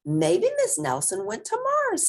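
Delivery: put-on high-pitched child voice; squeaky kid with a theory; guessing kid. A narrator's reading.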